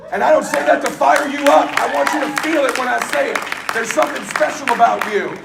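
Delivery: preacher shifting from shouting to heartfelt; preaching, easing down. Acted. Preaching winds down.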